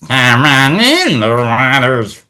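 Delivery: slow, drawn-out watery creature voice; slow bubbly sea-creature voice; Merman impression. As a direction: stretch it out like an underwater cartoon villain. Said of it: "doing his merman voice".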